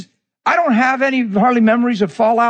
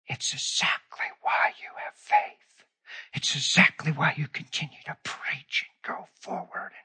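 whisper; 7 semitones lower, 13 dB quieter than usual. Retold or imitated. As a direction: hush it like a secret from God. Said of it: "He whispered".

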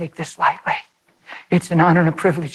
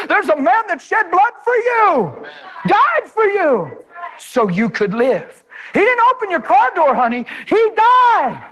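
shouting preacher; 10 semitones higher, slower than usual. Real, not acted. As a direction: shout it out from the pulpit. Preaching climax.

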